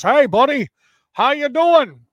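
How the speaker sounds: loud, high hammed-up buddy greeting. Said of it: "they're thinking you're talking like this".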